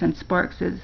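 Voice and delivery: plain reading voice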